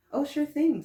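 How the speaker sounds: bright, eager yes; cheerful toothbrush. A narrator's reading. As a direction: toothbrush happily agreeing. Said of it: "said Sony".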